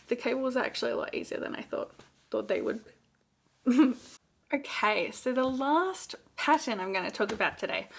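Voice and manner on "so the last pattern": short of breath while talking. Real, not acted. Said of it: "I feel like I'm running out of breath".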